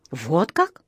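ordinary delivery, nothing marked

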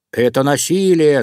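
loud, slightly high-pitched protest; mildly squealed wolf-cub outrage; wolf cub's squeal. A narrator's reading. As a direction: protest loudly as a little wolf. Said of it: "завизжал волчонок".

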